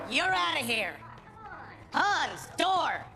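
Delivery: loud, high shouted order; commanding shout, high and punchy; ejecting a troublemaker. Acted. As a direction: call out for the bouncer. Throwing a heckler out.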